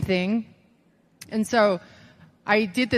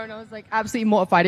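ordinary talk, nothing marked

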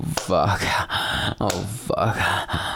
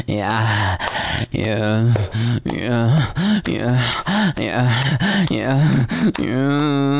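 building yeahs; 6 semitones higher, much slower than usual. Acted. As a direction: climb steadily toward the peak. Building to climax.

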